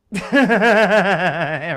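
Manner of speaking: long, loud rippling laugh; loud rolling belly laugh. Real, not acted. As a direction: burst out in a big sustained laugh. Laughing at the dog story.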